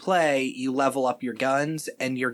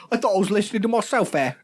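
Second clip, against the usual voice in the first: higher, louder mock British voice; British accent impression; 8 semitones higher, 4 dB louder, faster than usual. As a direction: keep the actor's put-on accent going. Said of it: "continues Boyega role".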